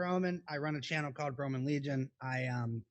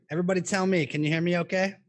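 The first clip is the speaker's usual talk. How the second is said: raised, louder voice asking the audience; calling out to viewers; 4 semitones higher, 11 dB louder than usual. Real, not acted. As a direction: project it so the chat answers. Checking if his audio works.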